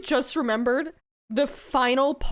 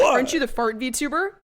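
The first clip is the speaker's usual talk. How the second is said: ordinary talk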